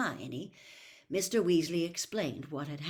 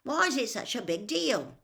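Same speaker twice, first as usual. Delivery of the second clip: impatient; 7 semitones higher, 6 dB louder than usual. A narrator's reading. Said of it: "said Ron impatiently".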